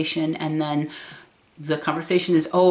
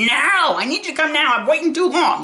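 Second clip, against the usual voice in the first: put-on higher, louder insistent old mother; pushy mother voice, raised; imitating demanding mom; 10 semitones higher, 4 dB louder than usual. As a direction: demand it now, impatient.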